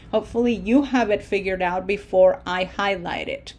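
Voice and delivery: normal talking voice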